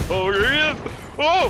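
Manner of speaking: being chased. Real, not acted. Spotted while being chased.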